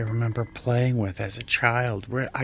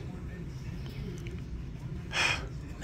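dreamy sigh; 9 semitones higher, 9 dB quieter than usual. Real, not acted. Dreamy sigh over lamps.

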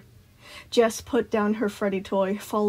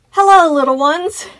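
loud, high, sing-out hello; enthusiastic greeting; 8 semitones higher, 13 dB louder than usual. A narrator's reading. Sun greeting children from his tower, enthusiastic call. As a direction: greet them with all the enthusiasm you can muster. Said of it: "putting as much enthusiasm as he could muster".

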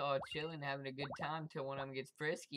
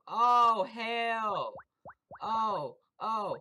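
very loud, high yell of alarm; shocked scream; 8 semitones higher, 12 dB louder, much slower than usual. Real, not acted. Gamer caught by a big player, loud shocked scream. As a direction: scream as you get caught. Sudden ambush in game.

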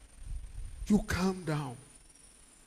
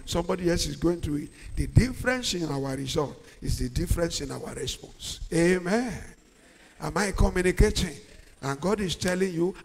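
ordinary talk, nothing marked

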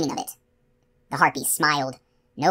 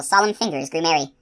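ordinary delivery

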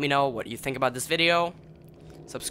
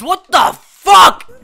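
slow, stretched real scream, much higher and louder; furious scream; 19 dB louder, much slower than usual. Real, not acted. Gamer screaming at his game glitching, slow furious scream. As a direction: scream it out in disbelief. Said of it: "for screaming and yelling".

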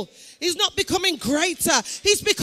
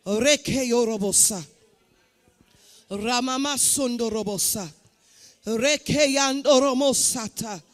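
speaking in tongues; 5 semitones lower than usual. Real, not acted. Preacher prays in tongues over the congregation.

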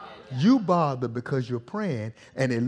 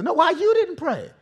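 high-pitched exasperated challenge; acted indignant questioning; frustrated demand; 6 dB louder than usual. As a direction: cut off the excuse, pitch shooting up. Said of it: "I don't want God frustrated with me".

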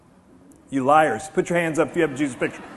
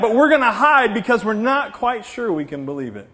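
ordinary talk

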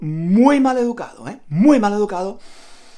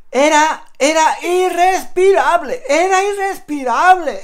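loud and high, outraged at the stink; indignant outburst; 7 semitones higher, 7 dB louder than usual. Retold or imitated. Telling Juan about the train, loud indignant complaint. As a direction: shout it like the air is unbreathable.

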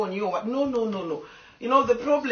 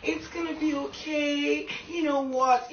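higher, sugary mock-comforting voice; mocking sympathisers; 6 semitones higher, slower than usual. Mocking fans who say it's gonna be okay, higher sugary mock comfort. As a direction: parody the fans who only offer pity. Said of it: "I don't breathe people who come and say".

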